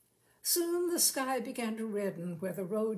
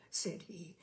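ordinary delivery

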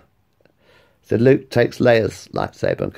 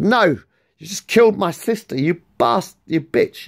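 angry Luke; 9 semitones higher than usual. Acted. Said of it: "Luke stresses out and says".

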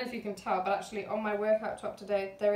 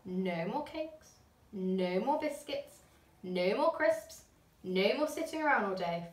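each "more" leaned on, slow and firm; firm list. A narrator's reading. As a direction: lay down the rules one by one. Mum laying down the rules.

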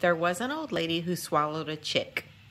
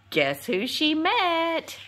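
higher, slow, drawn-out teasing question; teasing suspense; 7 semitones higher, slower than usual. Acted. Narrator teasing young listeners before the big reveal, slow and higher. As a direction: dangle the surprise for the kids.

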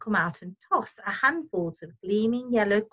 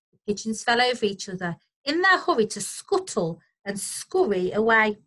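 ordinary delivery, nothing marked

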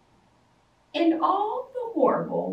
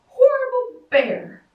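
high, loud furious refrain; angry shout; 9 semitones higher, 7 dB louder than usual. A narrator's reading. Girl stomping through the meadow, angry high shout. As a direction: spit it out in a temper.

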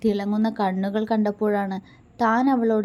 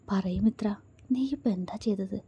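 seductively; 4 dB quieter than usual. A narrator's reading. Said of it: "അവൻ വശ്യമായി ചോദിച്ചു".

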